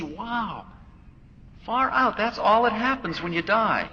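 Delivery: soul realizing death is nothing, delighted wonder; amazed wonder. Retold or imitated. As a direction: marvel like a kid seeing fireworks. Awakening soul marvels at death.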